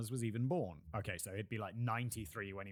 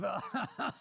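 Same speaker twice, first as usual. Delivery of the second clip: higher, hammed-up caricature laugh; mock French laugh; 8 semitones higher than usual. French listener scoffing at the British presenter, mock French laugh.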